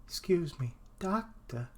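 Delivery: lightly voiced character, lifting on "doctor"; slipping into character voice. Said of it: "I slip into the voices".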